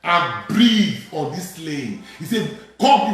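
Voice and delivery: forceful scripture preaching. Real, not acted. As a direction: summon the breath in ringing phrases.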